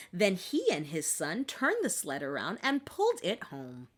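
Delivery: ordinary delivery, nothing marked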